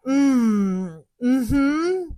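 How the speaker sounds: shy murmur of a yes. Retold or imitated. Psychic asked by cab driver, shy reluctant hum. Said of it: "I get so shy".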